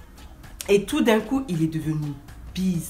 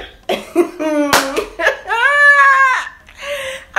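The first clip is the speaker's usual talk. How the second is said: high screaming burst of laughter; scream-laugh in disbelief; shrieking laugh; 7 dB louder than usual. Real, not acted. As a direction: howl with shocked laughter. Reacts to his invitation.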